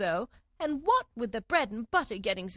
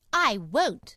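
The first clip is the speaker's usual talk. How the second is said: loud, higher refusal; defiant; 4 semitones higher, 7 dB louder than usual. A narrator's reading. Alice refuses the Queen, loud defiance.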